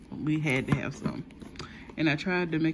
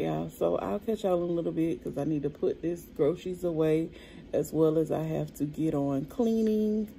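ordinary talk

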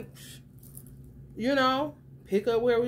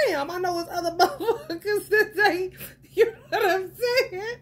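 laughing; 7 semitones higher, 3 dB louder than usual. Real, not acted. Quoting herself, laughing.

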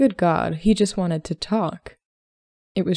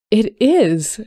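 higher, louder indignant outburst; bursting out; 4 semitones higher, 7 dB louder than usual. A narrator's reading. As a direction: blurt it out in protest. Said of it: "she burst out".

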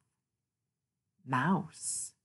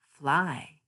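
ordinary delivery

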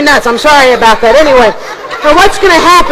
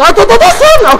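puppet mispronouncing; 7 semitones higher than usual. Acted. Puppet mangles magician.

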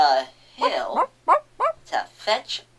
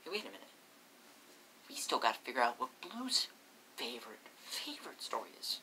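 hushed; 5 semitones lower, 15 dB quieter, much faster than usual. Real, not acted. Quiet aside, fast.

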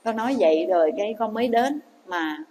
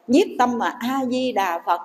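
usual speaking voice

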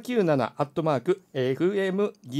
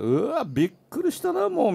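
a higher, startled うわ, びっくりしたな rising; mock surprise; 12 semitones higher than usual. Host imitating a guest's startled reaction, mock surprised. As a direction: act out a little fright, lightly. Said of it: "みたいな".

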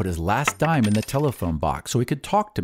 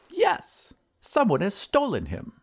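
very high wizard voice; high-pitched, put-upon wizard; aggrieved wizard; 10 semitones higher, slower than usual. Acted. Wizard laments his stolen dog.

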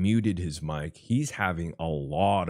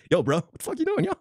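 much higher, mocking street-bro voice; mock bro scolding; 14 semitones higher, much faster than usual. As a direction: act out a bro yelling at his dog. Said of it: "You say".

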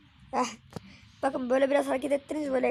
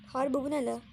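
ordinary talk, nothing marked

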